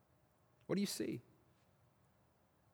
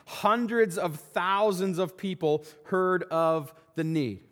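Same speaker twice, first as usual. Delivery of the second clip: usual speaking voice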